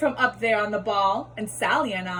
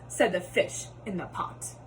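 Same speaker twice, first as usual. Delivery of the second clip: plain reading voice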